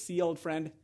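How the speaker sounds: quiet, knowing payoff line; aside to a friend. Acted. Leonidas to his friend Daxos, quietly making his point. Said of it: "he turns around to the, to his, to his friend Daxos".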